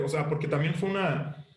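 ordinary delivery

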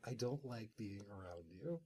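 low, hushed grumble of the aloof jet; grumpy Cyclonus. Retold or imitated. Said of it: "And Cyclonus is like".

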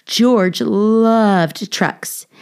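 ordinary delivery, nothing marked

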